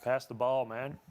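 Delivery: mock American accent, pass the ball. Shown as a demonstration. Asked for an American accent, tries a American accent. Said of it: "how would you say it in an American accent?".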